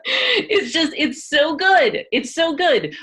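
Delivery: half-laughing good-natured concession. Real, not acted. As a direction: laugh while grudgingly admitting it. Laughing while conceding breath work.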